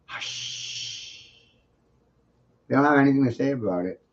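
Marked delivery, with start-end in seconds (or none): 0.1-1.3 a breathy whispered hush, barely there. Shown as a demonstration. Showing how truth is whispered into an ear, whispered hush. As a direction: whisper it right into their ear. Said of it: "whisper truth in their ear".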